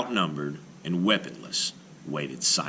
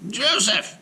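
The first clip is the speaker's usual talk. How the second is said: calling rabbi; 5 dB louder than usual. Acted. Rabbi calls golem.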